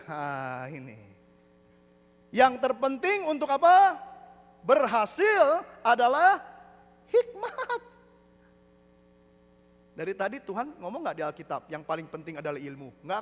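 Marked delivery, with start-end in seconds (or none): 2.3-7.8 loud, slow, drawn-out proclamation; declaimed scripture. Real, not acted. Preacher landing the verse for congregation, slow proclaiming. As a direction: stretch each phrase, climbing high on the last word.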